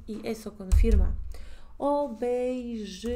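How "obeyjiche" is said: obeyjiche very slow, syllables stretched; slow sounding-out. Shown as a demonstration. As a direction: draw out each syllable of obeyjiche for learners. Teacher sounds out the word. (obeyjiche muy lento, alargando las sílabas)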